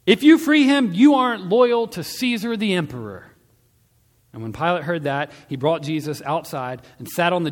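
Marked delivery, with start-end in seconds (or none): 0.0-3.3 high, full-force warning shout; crowd shouting. A narrator's reading. Judeans pressuring Pilate about loyalty to Caesar, shouting. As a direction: shout it as an accusing mob. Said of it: "the Judeans were shouting".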